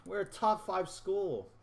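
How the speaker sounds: high-pitched, exasperated venting; frustrated. Real, not acted. Gamer venting about coming up short every year, frustrated. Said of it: "I'm just so frustrated".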